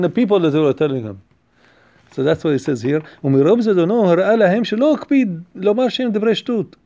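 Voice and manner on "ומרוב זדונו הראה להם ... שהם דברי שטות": quick, louder chanted reading of the commentary; text recitation. Acted. Rabbi reading the commentary to his class, fast singsong recitation. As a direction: rattle the Hebrew text off like a study-hall chant.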